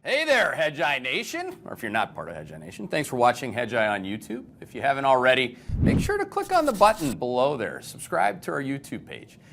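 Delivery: upbeat channel sign-off pitch; promo outro read. Acted. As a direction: sell the subscribe button to viewers.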